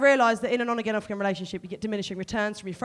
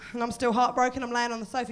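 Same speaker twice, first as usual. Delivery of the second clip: ordinary talk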